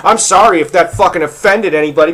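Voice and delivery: ordinary talk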